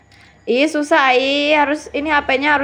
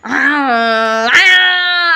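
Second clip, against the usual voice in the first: drawn-out roar swooping upward; pretend lion roar; 3 dB louder than usual. Host's lion threatening child, playful roar. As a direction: roar like a toy lion pouncing.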